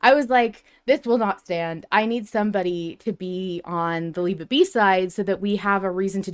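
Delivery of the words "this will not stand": ordinary talk, nothing marked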